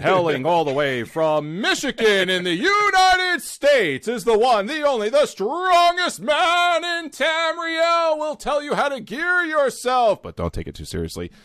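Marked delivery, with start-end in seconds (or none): 0.0-10.2 high, slow ring announcer build-up; hype announcer. Acted. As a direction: sell it like a boxing intro. Announcing Zephin like a boxer.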